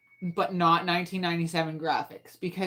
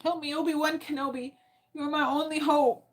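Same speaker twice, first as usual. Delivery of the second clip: a lightly voiced pleading princess, higher; mock plea to the search assistant, pitched up; Star Wars quote; 9 semitones higher than usual. Gamer begging Google for help like the movie, light mock plea. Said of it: "Do you quote Star Wars every day?".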